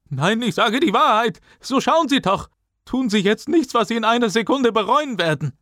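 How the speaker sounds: high-pitched desperate cries; frantic pleading. A narrator's reading. Teacher begging Booker not to push him, frantic. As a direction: plead for your life, voice way up high.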